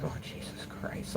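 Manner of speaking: a hushed, breathy whisper of disbelief; whispered dismay. Retold or imitated. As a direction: whisper it under your breath in horror. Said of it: "I was like".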